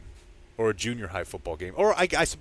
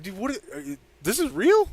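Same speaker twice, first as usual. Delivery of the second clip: high-pitched incredulous reaction; retold disbelief; 11 semitones higher, 3 dB louder than usual. Telling co-host of reaction to heckler, high-pitched disbelief. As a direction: squeak it in mock disbelief.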